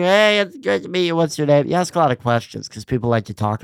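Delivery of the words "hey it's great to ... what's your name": higher, upbeat and welcoming; friendly fan greeting. Shown as a demonstration. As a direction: greet the fan like you're glad to meet them. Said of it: "I'm like".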